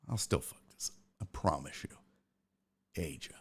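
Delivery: soft, low grumbled admission; quietly conceding he'll mess up the name; muttered aside. Real, not acted. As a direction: mumble it to yourself, resigned.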